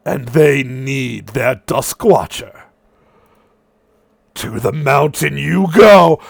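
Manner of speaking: a distant weird god mocking you from inside your head; Satanos in your head. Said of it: "you hear Satanos' words in your head".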